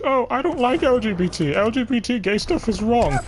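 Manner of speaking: high mock voice of close-minded people, sneering; mocking the bigots. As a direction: parody them in a high, sneering voice. Mocking narrow-minded people's views.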